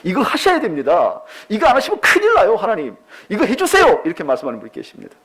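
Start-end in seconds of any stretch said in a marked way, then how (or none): 0.0-4.0 pushy pleading prayer. Retold or imitated. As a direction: imitate a believer pressing God hard. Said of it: "여러분 기도하실 때 이런 분이 있어요".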